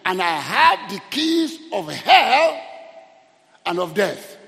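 loud, slow preacher's declaration; triumphant proclamation. Retold or imitated. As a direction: proclaim it slowly at full force. Proclaims Jesus holding the keys.

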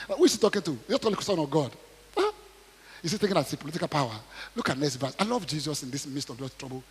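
usual speaking voice

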